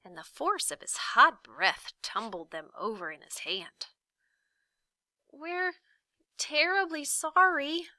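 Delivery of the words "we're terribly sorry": high, timid apology; meek boy. A narrator's reading. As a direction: shrink away as you apologise. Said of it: "said Milo meekly".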